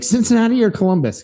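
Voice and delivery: quick high call of cities; high questioning call. Retold or imitated.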